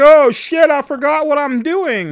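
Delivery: high, loud panicked cry; panicked yell. Retold or imitated. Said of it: "And I'm like".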